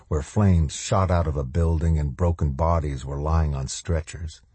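usual speaking voice

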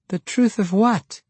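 high-pitched, pressing question; puzzled girl. A narrator's reading. Girl pressing Jacka on his cryptic answer, puzzled.

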